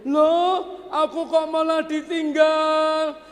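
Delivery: high, startled cry of a wife left behind; startled Siti Hajar. Acted. Wife left in the desert calls out, high and startled. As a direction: voice jumps up high in shock. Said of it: "Siti Hajar kaget".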